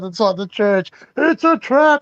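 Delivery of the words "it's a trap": megaphone yell. Pretend. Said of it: "yell out with a megaphone".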